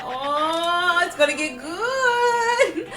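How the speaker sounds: high, slow, gleeful drawl; gleeful anticipation. Retold or imitated. Speaker watching rivals clash, slow and gleeful. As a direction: relish the coming fight. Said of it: "I'm like".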